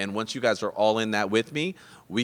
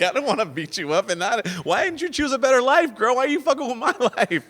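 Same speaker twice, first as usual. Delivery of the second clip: high, loud, hammed-up outrage; mock angry rant; 14 semitones higher, 5 dB louder than usual. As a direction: rant accusingly in a raised pitch, half laughing. Acts out talking himself into anger.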